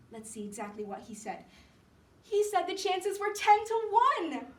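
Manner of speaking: pooh-pooh it brightly, building to a high, loud finish; scoffing, cheering Sue. Acted. Sue dismissing Johnsy's fancy, loud forced cheer.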